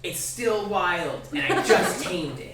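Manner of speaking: put-on high, self-important child voice, stretched warning; kobold kid. Kobold inviting kids onto his tamed bear, proud child voice. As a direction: play the proud little beast tamer. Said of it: "And then he says".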